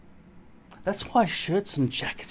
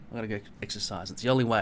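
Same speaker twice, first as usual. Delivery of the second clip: normal talking voice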